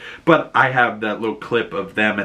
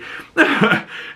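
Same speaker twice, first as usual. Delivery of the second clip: airy high laugh. Real, not acted. Laughing at Taco Bell joke.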